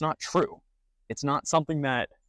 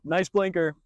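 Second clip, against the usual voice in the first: higher, louder mock-friendly "Nice blinker"; sarcastic jab; 7 semitones higher, 4 dB louder, slower than usual. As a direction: toss it out with cheeky sarcasm. Sarcastic jab at passing driver.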